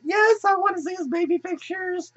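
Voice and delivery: high, excited squeaky voice; eager Twilight. Retold or imitated. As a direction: gush it like a giddy fan.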